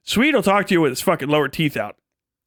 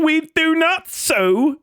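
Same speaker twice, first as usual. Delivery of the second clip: Ironborn quote; 8 semitones higher, slower than usual. Retold or imitated. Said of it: "It's a whole country full of Ironborn".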